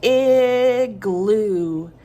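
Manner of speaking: Igloo very stretched, "iiih-glooo"; drawn-out first sound. Shown as a demonstration. Teacher isolating first sound for children, long I in Igloo. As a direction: hold the opening short i of Igloo long and firm. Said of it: "The first sound is".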